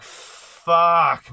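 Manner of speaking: sudden loud high blurt of shock; shocked curse. Real, not acted. Seeing his charge next to a little girl, loud shocked blurt. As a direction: blurt it out, caught off guard.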